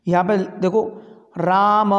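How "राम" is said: "राम" with the final vowel held long and level; sustained, calling "राम"; stretched pluta vowel. Shown as a demonstration. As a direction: drag out the last "a" of "राम". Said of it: "ऐसे ही तो करना है".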